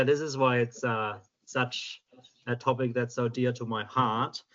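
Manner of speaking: usual speaking voice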